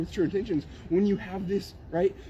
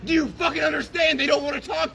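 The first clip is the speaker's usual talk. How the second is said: furious shout; 8 semitones higher, 5 dB louder than usual. Real, not acted. Yelling that people don't want to hear him, furious. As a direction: hammer every word in rage. Heated argument with preacher.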